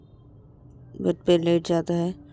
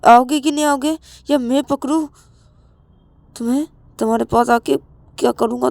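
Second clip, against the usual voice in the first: threatening; 6 semitones higher, 6 dB louder, faster than usual. Acted. Rahul threatens to grab Ravi.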